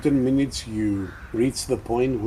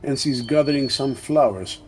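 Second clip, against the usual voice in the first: normal talking voice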